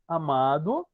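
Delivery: ordinary talk, nothing marked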